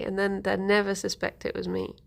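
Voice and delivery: ordinary talk, nothing marked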